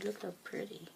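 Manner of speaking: soft whisper. Real, not acted. Whispering over tarot cards.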